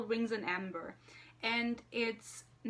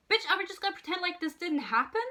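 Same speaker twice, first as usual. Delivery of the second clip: indignant scolding; 8 semitones higher, 4 dB louder, faster than usual. Acted. Scolding the character Nesta.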